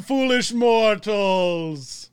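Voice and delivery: slow, drawn-out booming ghost host welcome; spooky ghost host. Acted. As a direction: greet the guests like a haunted house host. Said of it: "my spooky microphone".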